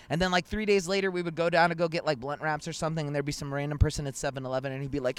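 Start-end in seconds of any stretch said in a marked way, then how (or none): none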